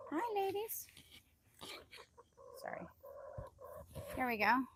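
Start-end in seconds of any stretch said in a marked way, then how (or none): 0.1-0.9 cooing greeting. Real, not acted. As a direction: greet the hens way up high. Greeting hens.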